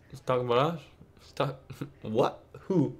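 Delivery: half-laughing disbelief, trailing off soft; a baffled what, who, breaking into a laugh; incredulous. Retold or imitated. Friends overhearing an insult, reacting with amused disbelief. Disbelief at being called losers.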